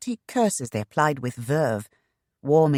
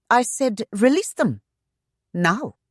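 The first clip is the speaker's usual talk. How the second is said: firm, bitingly calm; 9 semitones higher, 4 dB louder than usual. A narrator's reading. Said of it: "Shanna's tone was firm, yet bitingly calm".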